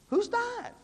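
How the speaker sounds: very high-pitched mock bafflement; puzzled question. Retells own puzzled question to supervisor.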